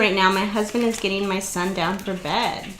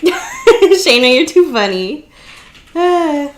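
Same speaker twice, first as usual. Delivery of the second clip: laughing out the words; amused, laughing loudly through it; 7 semitones higher, 9 dB louder, much slower than usual. Real, not acted. Laughing at a viewer's comment.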